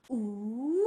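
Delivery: bend low on "Wee!" then swoop upwards; dipping third tone. Shown as a demonstration. Teacher mimics bouncing off the board, "Wee!" dipping then rising. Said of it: "You kind of go".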